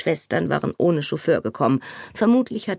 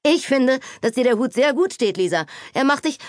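calling out loud; 7 semitones higher than usual. A narrator's reading. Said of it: "Laut rief er".

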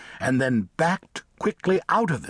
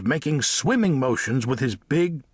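plain reading voice

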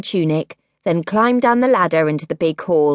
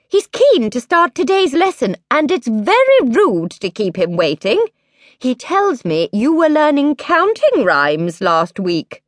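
queen mother; 6 semitones higher than usual. A narrator's reading. Queen voiced by narrator.

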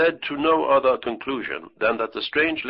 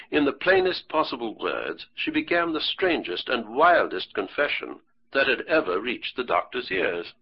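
ordinary delivery

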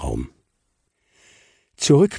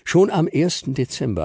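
ordinary delivery, nothing marked

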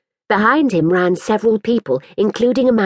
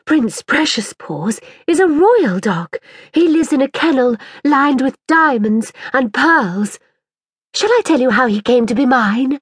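higher, grand and boastful, unhurried start; lightly voiced proud girl; 7 semitones higher than usual. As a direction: boast about the royal dog. Mirabel's haughty voice.

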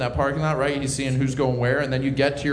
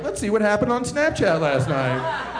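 hungover curiosity; 7 semitones higher than usual. Retold or imitated. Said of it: "you're like".

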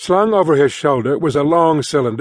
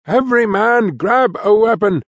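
captain's call to arms, pitched up; rally the crew to grab weapons; captain rallying the crew, mock yelled order; 7 semitones higher than usual. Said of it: "he yelled".

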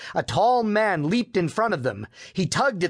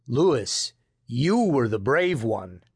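a hint of a lower grandfather voice; reply a little lower, as the grandfather; lightly voiced grandfather; 4 semitones lower than usual. A narrator's reading. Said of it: "Grandfather Wallace replied".

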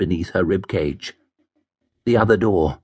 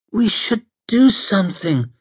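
distressed urging; 11 semitones higher than usual. Acted. Alicia urges help for stabbed boy.

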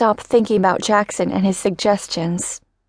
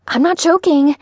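insistent; 7 semitones higher than usual. Acted. Louise insists about the gun.

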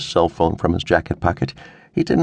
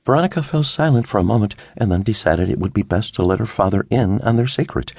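plain reading voice

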